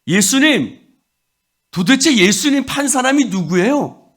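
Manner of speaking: high, loud, insistent protest of innocence; ramping up to a shouted demand; indignant protest. Acted. Disciple's indignant protest voiced.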